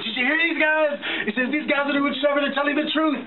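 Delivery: high-pitched excited rant, pitch way up; retold screaming. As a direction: rant like an outraged truck driver. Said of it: "The truck driver started screaming".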